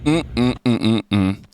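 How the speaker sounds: usual speaking voice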